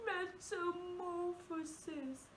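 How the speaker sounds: a mock sob on the word; really sad. Shown as a demonstration. Teacher repeating the word sadly. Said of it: "like we're really sad".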